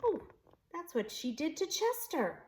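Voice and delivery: usual speaking voice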